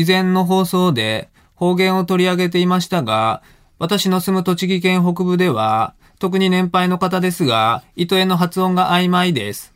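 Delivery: high sustained Tochigi-dialect recitation, dropping at each phrase end; dialect schoolchild reading aloud. Acted. Host demonstrates a dialect-speaking child reading a listener's email, countrified recitation. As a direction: read like a schoolchild with a thick Tochigi accent. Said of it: "小学生のなまった子供".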